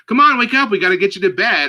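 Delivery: rousing the sleeping niece to carry her to bed; coaxing wake-up call. Retold or imitated. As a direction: chirp them awake. Trying to wake sleepy child.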